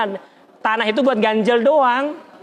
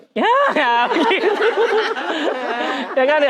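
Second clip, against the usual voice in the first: much higher pitch, breaking into laughter; laughing exclaim; 6 semitones higher than usual. Real, not acted. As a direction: laugh at the sight of it. Laughing at the demonstration.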